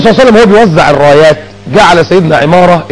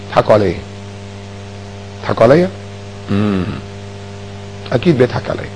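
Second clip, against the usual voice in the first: low, hushed self-talk; suspicious muttering; 8 semitones lower, 12 dB quieter than usual. Retold or imitated. A man who thinks he's laughed at, mutters low. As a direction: mutter your suspicion under your breath.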